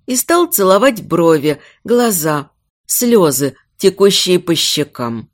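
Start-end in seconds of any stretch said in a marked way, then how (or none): none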